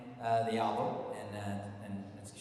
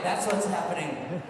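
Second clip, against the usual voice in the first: ordinary delivery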